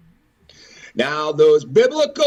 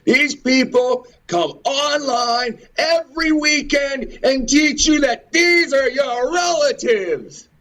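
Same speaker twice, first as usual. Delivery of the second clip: raised ranting voice; 10 semitones higher than usual. Real, not acted. Ranting at online teachers who mislead people, shouting.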